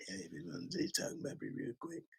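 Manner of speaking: whispered, barely audible mumble; mock a man nobody can hear; talking low. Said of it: "speaking too low".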